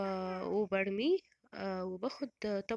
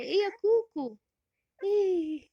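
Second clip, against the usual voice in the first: high, loud sing-out to a beloved pet; pitched way up, playful pet talk; calling a pet; 9 semitones higher, 7 dB louder than usual. Real, not acted. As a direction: coo it brightly and loudly to Koko. Calling to her pet Koko.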